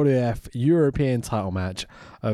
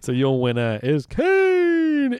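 drawn-out, higher winner announcement; mock ring announcer; 4 semitones higher, 4 dB louder, slower than usual.

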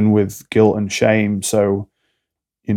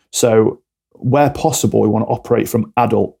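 normal talking voice

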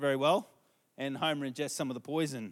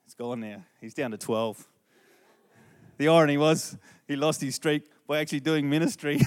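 usual speaking voice

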